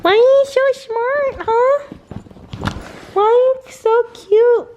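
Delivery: very high, slow cooing praise. Real, not acted. Owner gushes over her smart cute dog in slow high baby talk.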